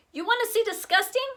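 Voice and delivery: high, fast mocking jab; taunting comeback. A narrator's reading. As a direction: fire back a schoolyard insult. Kids trading insults.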